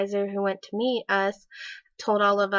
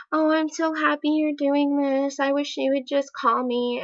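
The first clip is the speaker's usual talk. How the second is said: high-pitched mock sweetness; imitated happy gushing; 6 semitones higher than usual. Boyfriend's next voicemail to girlfriend, imitated happy gushing. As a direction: gush lightly, imitating a clingy message. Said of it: "happy, sad".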